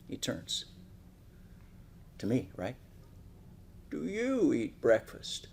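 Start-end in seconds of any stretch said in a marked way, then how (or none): 3.9-5.5 lightly voiced slow, soft old man; a hint of a frail old man, unhurried; mildly slowed old man asking the boy. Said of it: "He talks slow".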